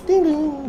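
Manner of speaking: high, loud sung-out doorbell chime; doorbell imitation. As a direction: mimic a ringing doorbell.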